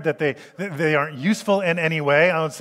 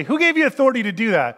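louder, higher, fast challenge; indignant leaders; 6 semitones higher, 4 dB louder, faster than usual. Retold or imitated. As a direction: snap the question like an offended priest. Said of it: "and they would say".

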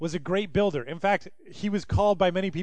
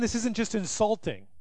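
usual speaking voice

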